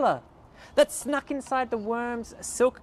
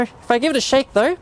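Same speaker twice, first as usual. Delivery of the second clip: ordinary talk, nothing marked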